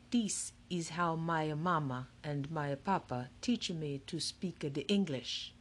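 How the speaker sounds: put-on slow, shy Italian-accented English; timid Italian accent. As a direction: speak haltingly like a newcomer with an Italian accent. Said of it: "He spoke very timidly, very slowly".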